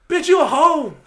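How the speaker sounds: loud, high retold yell. Retold yelling at the woman.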